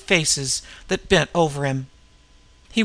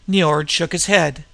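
usual speaking voice

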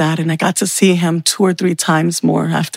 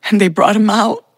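ordinary talk, nothing marked

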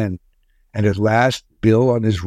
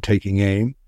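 ordinary delivery, nothing marked

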